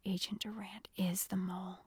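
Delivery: low, soft hushed revelation; whisper. A narrator's reading. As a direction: confide it under your breath. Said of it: "Jeremy whispered".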